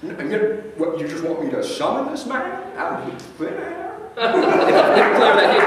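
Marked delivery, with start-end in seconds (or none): 0.0-3.9 put-on higher, stammering, drunk old-film drawl; Jimmy Stewart impression. A drunk bar patron questioned by the detective, stammering and higher. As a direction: stutter on every now like a tipsy Jimmy Stewart. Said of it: "He goes by Mister Stewart".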